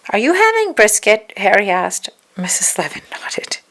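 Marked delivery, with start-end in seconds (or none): 0.1-1.3 put-on high, loud hopeful kid; eager little boy. A narrator's reading. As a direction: ask it all hopeful.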